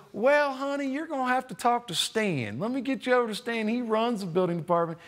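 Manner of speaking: put-on high, sweet rural Kansas accent; a friendly small-town Kansas clerk in a rural accent. Clerk passing the caller to Stan, kindly, in a rural Kansas accent. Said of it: "She had, you know, an accent".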